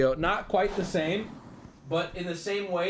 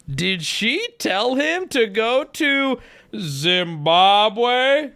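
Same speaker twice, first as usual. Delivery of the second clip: high, loud, very drawn-out question; mock disbelief; 7 semitones higher, 5 dB louder, much slower than usual. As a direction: stretch every word in hammed-up astonishment.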